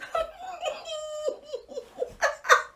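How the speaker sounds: high, thin, stifled mock sob; mock crying. Crying beside her friend, high stifled mock sobs. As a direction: squeak out imitation crying, held in. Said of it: "and I'm crying".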